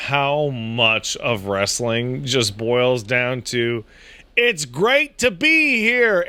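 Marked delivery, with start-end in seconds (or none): 4.4-6.3 high, projected hammy hello; big mock stage announcement; wrestler's crowd greeting. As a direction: greet an arena like a showman.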